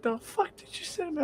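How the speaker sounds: very high, soft squeak of outrage; squeaky mock outrage; angry girlfriend. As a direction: imitate her indignation in a tiny high voice. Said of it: "She'd get angry at me".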